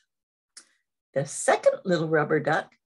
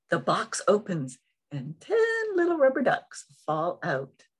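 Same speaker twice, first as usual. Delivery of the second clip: ordinary delivery, nothing marked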